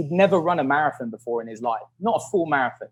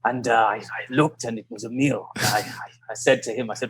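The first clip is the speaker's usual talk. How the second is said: low, soft, halting reminiscence; imitated old rival; 4 semitones lower, 4 dB quieter than usual. Old British runner telling the documentary, imitated low and halting. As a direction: remember it haltingly, pitched down and softer.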